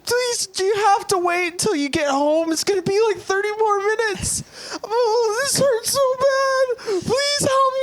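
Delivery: very high, hammed-up sobbing plea; mock crying. Penis begging its owner to hold on, mock crying. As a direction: wail and beg in a put-on tiny voice. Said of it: "he starts, like, crying".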